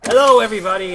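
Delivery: usual speaking voice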